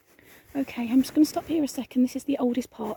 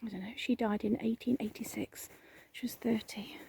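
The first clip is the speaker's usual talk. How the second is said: whisper; 9 dB quieter than usual. Real, not acted. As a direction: read the stones in a whisper.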